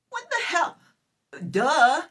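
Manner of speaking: high, loud what the hell then duh; an exasperated what the hell, duh; incredulous outburst. Real, not acted. Scoffing at his remark.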